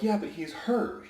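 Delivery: mock coach protest, pitched high; complaining coach. Coach protesting the hurt player, mild mock whine. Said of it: "Coaches are like".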